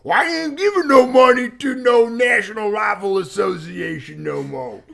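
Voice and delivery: high, loud, slow put-on shouting accent; lawnmower guy voice. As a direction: yell into the phone in a ridiculous put-on accent, way up high. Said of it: "you did that crazy accent".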